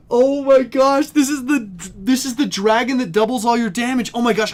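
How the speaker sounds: high, loud, breathless excitement; real fan freaking out over a find; gushing at a high pitch; thrilled. Real, not acted. Overjoyed at the pull.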